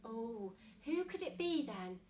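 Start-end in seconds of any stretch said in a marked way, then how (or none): none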